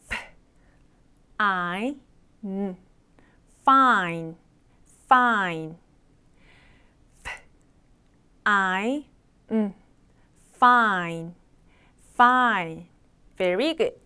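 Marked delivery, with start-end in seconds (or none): 0.0-5.7 "F", "I", "음" separately, then "fine" whole, lower; teacher sounding out "fine" piece by piece; sound-by-sound demo. Shown as a demonstration. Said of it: "발음 기호 같이 한번 연습해". 7.2-12.9 "F", "I", "음" separately, then "fine" whole, lower; teacher sounding out "fine" piece by piece; sound-by-sound demo. Shown as a demonstration. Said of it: "발음 기호 같이 한번 연습해".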